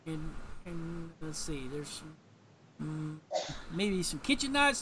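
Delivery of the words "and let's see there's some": normal talking voice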